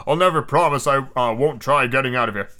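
a lightly voiced angry harpooner; mild mock defiance. Denley refuses the captain, with understated anger. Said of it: "Denley shouted".

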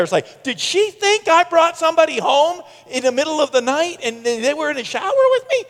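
ordinary talk

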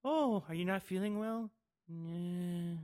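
quietly acting out both parts; mock dialogue voices. Podcaster replaying the movie scene, lightly voiced. Retelling the scene, voicing the characters.

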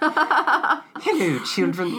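[name] impression. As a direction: greet the children like Mary Poppins. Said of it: "Ladies and gentlemen, [name]".

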